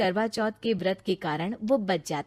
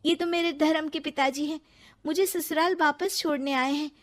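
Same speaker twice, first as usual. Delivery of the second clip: higher, earnest pleading; pleading young wife; 6 semitones higher than usual. Acted. Ritu pleading with her mother-in-law, earnest. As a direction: defend the sage gently.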